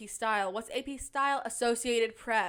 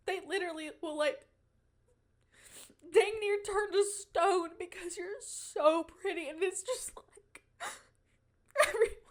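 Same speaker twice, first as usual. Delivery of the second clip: mock crying; 7 semitones higher than usual. Mock tearful speech on beauty.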